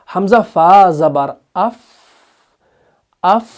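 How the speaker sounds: spelled out slowly, then "अफ" with a fading airy f; weak hams reading. Shown as a demonstration. Teacher showing fa read with weakness, "अफ" released in air. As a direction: let "अफ" trail away on breath. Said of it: "कमजोरी के साथ अदा करना है".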